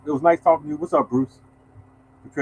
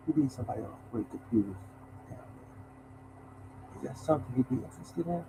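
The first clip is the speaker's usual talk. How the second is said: Dracula voice; 6 semitones lower, 17 dB quieter than usual. Retold or imitated. Vice president greeting the mailman, in a hushed Dracula voice. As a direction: lean in and purr it like a count. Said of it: "He talked like Dracula".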